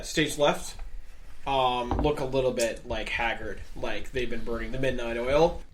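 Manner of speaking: normal talking voice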